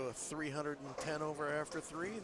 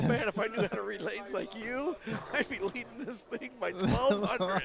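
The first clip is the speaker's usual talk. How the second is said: mock buddy quote; 6 semitones higher, 7 dB louder than usual. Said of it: "I could look at you and go".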